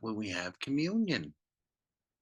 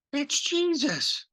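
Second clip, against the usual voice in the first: excited recognition; 3 dB louder, slower than usual. Acted. Disciples recognize Jesus on beach.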